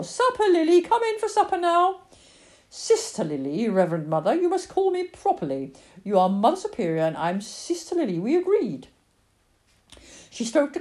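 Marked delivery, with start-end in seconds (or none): 0.0-2.0 high, loud mother's call. Acted. Mother calls Lily from the back door, high sing-out. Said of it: "Her mother was calling her from the back door". 2.7-8.9 bossy little girl playing nun; insistent child. Lily corrects her mother about their convent game, prim and insistent.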